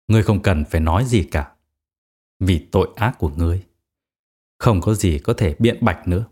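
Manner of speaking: low-pitched, cold dismissal; cold verdict. A narrator's reading. Executioner silencing the condemned man's plea, cold voice.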